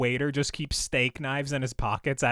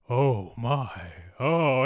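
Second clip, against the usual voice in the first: Shatner; 5 semitones lower, much slower than usual. Retold or imitated. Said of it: "he was very much like a".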